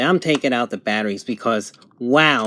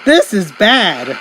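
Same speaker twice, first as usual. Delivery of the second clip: slow, higher, laughing through it; laughing disbelief; 4 semitones higher, 3 dB louder, slower than usual. Real, not acted. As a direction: chuckle out the words in disbelief. Laughing at the bad reception.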